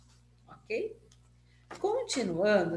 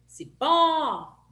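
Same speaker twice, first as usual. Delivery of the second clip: PÓ in "cipó" long and high; "cipó" with a raised, stretched PÓ; stress on PÓ; 6 semitones higher, 4 dB louder than usual. Shown as a demonstration. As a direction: call out "cipó", holding the last syllable. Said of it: "fala mais quando a gente chama".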